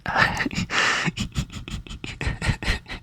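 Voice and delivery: breathy laugh under the breath; whispered laugh. Real, not acted. Laughing off the silly bit in a whisper.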